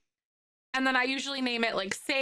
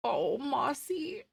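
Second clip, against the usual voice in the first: tender coo; 4 semitones higher, much slower than usual. Real, not acted. Cooing over a dog photo.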